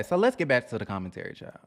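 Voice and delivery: usual speaking voice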